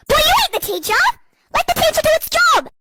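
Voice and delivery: high-pitched indignant outburst; exasperated telling-off. Acted. Classmate tells off the teacher's pet, exasperated.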